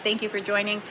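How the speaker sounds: normal talking voice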